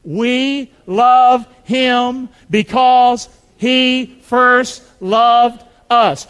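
very slow, loud unison chant; slow call-and-response recitation; recited with the congregation. Real, not acted. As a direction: lead the room word by word. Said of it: "Say it with me".

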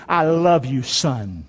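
low fatherly tenderness, dropping on the last word; tender father. Acted. God the Father to his son, low and tender. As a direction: speak low, as a loving father. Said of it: "He also said this to him".

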